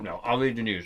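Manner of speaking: talking through a mouthful of cake; words round a bite of cake; mouth full. Real, not acted. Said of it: "Excuse me for speaking with my mouth full".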